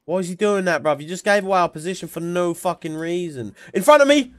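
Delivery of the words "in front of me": urgent callout. Real, not acted. Calling out enemy in firefight.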